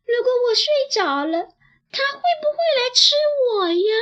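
put-on a frightened little kid crying to mom; scared child. A narrator's reading. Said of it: "小孩子说". (小孩害怕地带着哭腔快速说)

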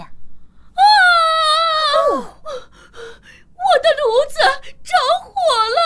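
alarmed shout, pitch rising high; panicked cry. Acted. Stove catches fire, cries out. (惊慌大喊)

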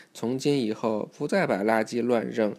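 usual speaking voice

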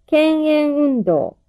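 slow, careful dictation; each word said slowly and clearly; dictating. Shown as a demonstration. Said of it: "書き取ってください".

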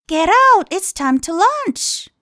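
high, drawn-out storybook call; queen calling out. Acted. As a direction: call the king out for lunch. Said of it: "王后的办法就是告诉他".

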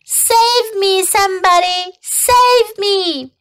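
high, frantic piglet wail; desperate high cry for help; panicked screaming. A narrator's reading. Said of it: "screamed Wilbur".

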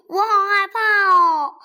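high loud frightened cry; terrified little dinosaur; scared child. Acted. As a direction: wail in fear, clinging on. Baby clings, scared.

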